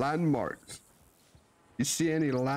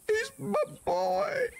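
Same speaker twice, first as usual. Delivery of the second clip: excited; slower than usual. Real, not acted. Proud about son.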